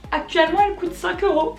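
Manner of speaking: high-pitched disbelief; dismayed surprise. Real, not acted. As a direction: blurt it out in dismay at the price. Discovers the dress is half price.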